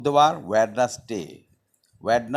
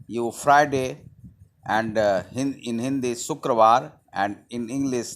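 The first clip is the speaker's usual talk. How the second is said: ordinary delivery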